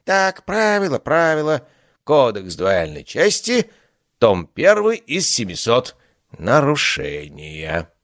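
high, drawn-out pompous reading; vain god voice. Acted. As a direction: leaf through the rules pompously. God searching the code.

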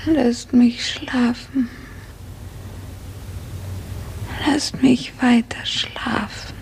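drowsy, breathless whisper, slow; barely awake, whispering to be left asleep; weak whisper. Acted. Erwacht schwach im Fieber. (schwaches, schläfriges Flüstern)

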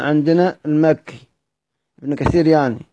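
ordinary talk, nothing marked

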